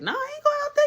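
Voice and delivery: very high falsetto voice; mock high-pitched protest; imitated friend refusing. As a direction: act out a friend squeaking a refusal.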